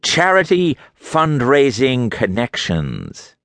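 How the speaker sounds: slow, sneering list of words; contemptuous. A narrator's reading. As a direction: spit each word out with contempt. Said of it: "his mouth twisted with contempt".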